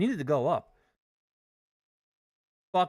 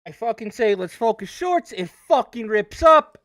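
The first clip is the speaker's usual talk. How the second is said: angry rant; 8 semitones higher, 10 dB louder than usual. Real, not acted. Trader ranting at the market.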